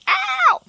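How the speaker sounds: sudden very high squealing "Ow!"; shocked yelp. Retold or imitated. Reacting as the cat runs into her house, high yelp. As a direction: shriek it like you got caught off guard. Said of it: "I was like".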